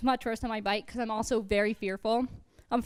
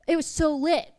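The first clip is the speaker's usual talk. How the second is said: higher, louder teen-slang impression; teenage cousin; 5 semitones higher, 4 dB louder than usual. Fifteen-year-old cousin using teenage lingo, mock teen voice. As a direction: imitate a teenager showing off slang. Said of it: "my fifteen-year-old cousin's like".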